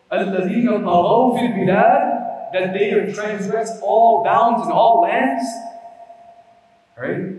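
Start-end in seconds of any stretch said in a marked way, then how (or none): 0.1-5.4 fiery preaching. Acted. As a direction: hammer home the transgression. Preaching at full force.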